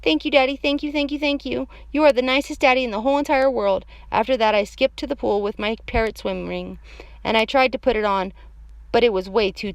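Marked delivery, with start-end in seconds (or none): 0.0-3.8 put-on high, gushing child's thanks; happy kid thanking over and over. A narrator's reading. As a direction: gush like a delighted little kid. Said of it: "very happy".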